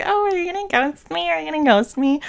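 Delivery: high, put-on needy questioning; imitated date. Retelling what the guy from a date asked, high mocking imitation.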